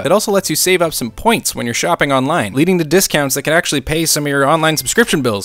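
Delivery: loud, fast, pumped-up sponsor pitch; excited ad read. Acted. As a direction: sell it like a hyped YouTuber.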